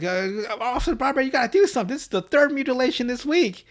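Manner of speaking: high, urgent pleading rancher voice. Retold or imitated. Rancher begging the officer to do something, high and urgent. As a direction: act out the panicked rancher.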